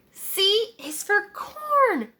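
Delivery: put-on drawn-out proud child; high slow kid voice; Tommy's voice. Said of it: "said Tommy".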